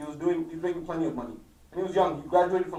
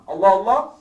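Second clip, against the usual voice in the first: "Allah, Allah" loud, pressed on both words; emphatic appeal; 9 dB louder than usual. Retold or imitated. Preacher repeating the Imam's plea, emphatic "Allah, Allah". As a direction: come down hard on "Allah, Allah". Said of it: "he's emphasizing".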